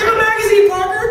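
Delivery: high, loud words through laughter; laughing mock dad. Dad teasing son Parker at dinner, laughing.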